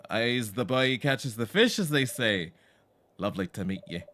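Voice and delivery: put-on loud, lilting villager in a muddled British-Isles accent; hammed-up regional British-Isles accent; uncertain British-Isles accent. Tobin welcomes the newcomer, hammed-up British-Isles accent. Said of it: "What is this accent?".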